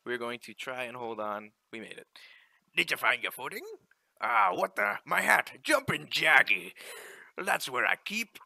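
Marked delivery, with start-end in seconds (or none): 2.7-8.4 louder, hearty sea captain reading the in-game lines; ship captain. Acted. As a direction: call it out like a captain on deck. Captain loses his hat.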